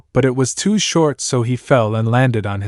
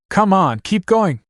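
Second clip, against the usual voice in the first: high-pitched urging, little extra volume; mild shout; 8 semitones higher than usual. A narrator's reading. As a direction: cheer them onward with a light shout. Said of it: "Viper shouted as they neared the end".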